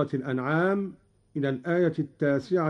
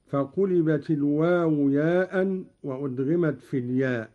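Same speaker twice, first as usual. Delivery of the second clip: normal talking voice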